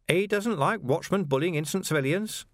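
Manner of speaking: high, chirpy villain with a laugh; mock innocence, grinning high; cheerful menace, high-pitched; Carcer's voice. A narrator's reading. Carcer mocking.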